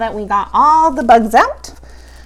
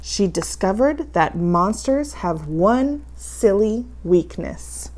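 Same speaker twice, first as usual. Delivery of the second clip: ordinary delivery, nothing marked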